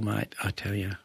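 ordinary delivery

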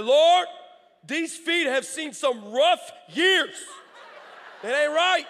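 loud, high, drawn-out prayer cry with a laugh; hammed-up lament to the Lord, slowly; a comic over-the-top prayer, half laughing; mock wailing prayer. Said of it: "That's very pastoral of me in this moment".

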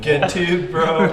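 high, loud laughing bro joke. Real, not acted. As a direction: cheer it out between laughs. Joking banter.

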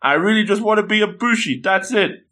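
high-pitched, worked-up frustration; mock angry outburst. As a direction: play a thwarted young hero venting. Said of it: "he's really angry".

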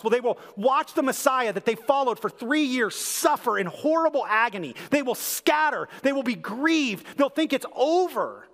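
rally the congregation toward the resurrection; impassioned preaching. Acted. Pastor building to the sermon's climax, impassioned and rising.

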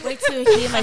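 high and loud with excitement; excited. Real, not acted. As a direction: hype up the slurp. Excited about slurping noodles.

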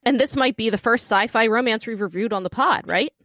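scripted, punchy show introduction with playful asides; lively scripted host narration; podcast intro read. Acted. Reads scripted podcast plot summary.

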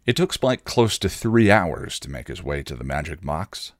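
plain reading voice

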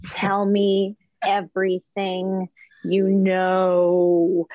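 very slow, stretched-out words; milk each word for the gossip; drawn-out demand. Retold or imitated. Acting out own eager demand for gossip.

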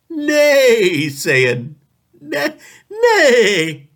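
high laughing neigh like a horse. Acted. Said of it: "Like a horse".

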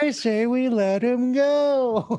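put-on very high, very slow man-child voice; Pee Wee Herman. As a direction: stretch it out like an eager kid. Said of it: "that was my Pee Wee Herman".

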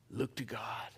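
whispered, hushed. Real, not acted. Pastor to congregation, hushed whisper. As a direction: lean in and breathe it to the church. Preacher whispers a key phrase.

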